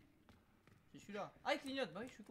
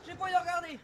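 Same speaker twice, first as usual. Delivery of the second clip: louder, dreading to look; scared horror game streamer; 6 semitones higher, 8 dB louder, much faster than usual. Real, not acted. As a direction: beg not to have to look.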